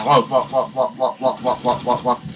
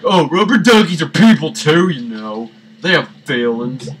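lightly raised mocking voice; mock preachy activist; 2 semitones higher, 5 dB louder than usual. Gamer mimics an imagined duck defender in a lightly raised mocking voice. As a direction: parody a duck-rights plea. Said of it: "might have, like".

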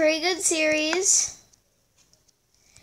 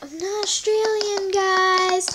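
high, sing-song complaint; mock plaintive protest; 6 semitones higher than usual. Player retelling her protest to teasing fans, plaintive. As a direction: protest in a high voice, drawing out the end.

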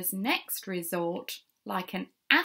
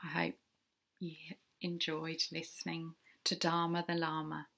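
soft, low and gentle; warm sign-off; 4 semitones lower, 10 dB quieter, faster than usual. Real, not acted. Reader thanking listeners after the story, warm. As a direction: close softly, sincere and low.

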